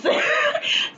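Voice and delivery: bursts out in a high-pitched laugh; a real giggle that cracks up high. Real, not acted. Vlogger cracks up retelling the pool scene, laughing. Laughing at her own story.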